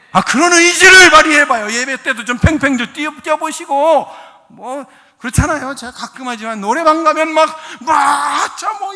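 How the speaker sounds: loud, high exhortation; rousing preaching. Real, not acted. Preacher urging people to jump and cheer in praise, shouting. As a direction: whip the congregation up with full voice.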